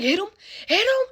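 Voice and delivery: high, loud mock command; urgent high-pitched order, repeated; yelling. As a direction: imitate a worked-up aunt yelling. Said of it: "I hear them yelling".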